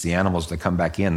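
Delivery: ordinary delivery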